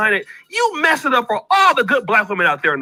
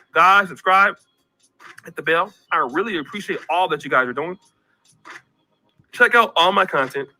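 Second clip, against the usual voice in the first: ordinary talk, nothing marked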